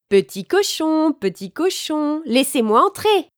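insistent wolf calling. A narrator's reading. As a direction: call at the door, building in volume. Loup insiste à la troisième maison. (loup qui insiste, voix aiguë)

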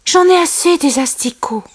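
high-pitched, weary character voice; fed-up sigh. A narrator's reading. Simba is sick of grubs, high fed-up voice. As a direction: complain high, as if sighing. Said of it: "soupira Simba".

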